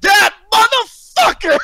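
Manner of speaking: high, loud, drawn-out mock scream; retold screaming. Fan re-enacting his reaction to the surprise entrance, screaming. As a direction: belt it out slowly like an overexcited fan. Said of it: "screaming off the top of my lungs".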